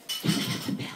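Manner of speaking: whispered, barely voiced; too polite to call out; timid whisper. Polite English dad asking the waitress, a timid whisper. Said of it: "And he goes".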